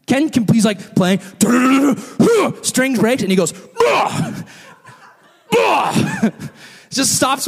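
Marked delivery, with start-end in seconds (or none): 1.4-2.5 loud, higher grunting strum sounds; mock strumming noises. Imitating the pastor strumming furiously, loud. 3.8-6.3 high mock yelps of surprise; startled yelp. Pastor yelping when his guitar string breaks.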